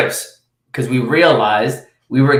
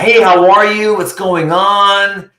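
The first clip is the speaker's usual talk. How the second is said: high, drawn-out fake-friendly hello; over-familiar greeting; 7 semitones higher, 3 dB louder, slower than usual. Salesman acting like he knows a stranger, over-familiar. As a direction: gush as if to an old pal. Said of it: "you don't know them".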